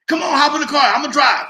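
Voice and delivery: high, loud shout; calling out. Retold or imitated. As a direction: holler him over to the car. Mobster calls him to car.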